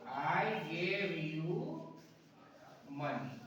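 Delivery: slow dictation. Shown as a demonstration. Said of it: "वाक्य जोरदार हो गया".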